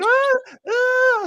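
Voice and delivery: high shrieking mock scream; mock woman's scream. Imitating the dog owner screaming as her dog gets grabbed, high mock shriek. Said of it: "That woman was screaming".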